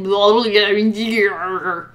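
slow, loud garbled grumble; drawn-out monster gibberish; grumbling goblin toad man. Acted. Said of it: "And he grumbles, he's like".

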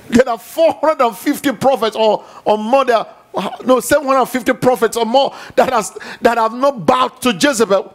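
high, loud, driving sermon; fiery preaching. Acted. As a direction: preach it at full force to the crowd. Preaching at full force.

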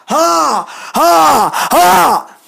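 high, shouted mock laugh, building; defiant ha. Real, not acted. Crowing that they survived the hurricane, defiant ha.

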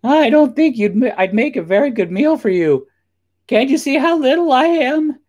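very high, loud goat squeal; squeaky goat talking its way out; frightened little goat. A narrator's reading. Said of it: "Little Billy Goat Gruff was very frightened".

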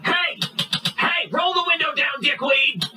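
high shouted order; angry command. Acted. Cop at the driver's window, angry shouting. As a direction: bellow at the driver as an irate cop.

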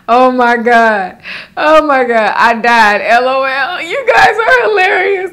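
high, loud laugh-crying shout; real laughing fit, loud and high; laughing till crying. Real, not acted. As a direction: shriek through tears of laughter.

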